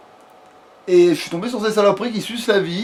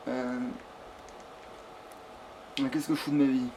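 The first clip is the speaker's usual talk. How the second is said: soft, low mutter to oneself; muttered self-reproach; 5 semitones lower, 15 dB quieter than usual. Real, not acted. Player to himself over his game, muttered self-reproach. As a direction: grumble it quietly, lower and softer. Despairs at own gameplay, muttered first.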